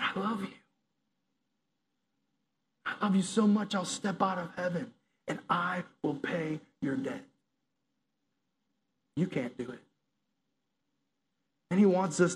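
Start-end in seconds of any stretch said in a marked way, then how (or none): 0.0-9.8 soft and quiet; gently, like comforting a child; tender. Pretend. Jesus telling us softly he loves us.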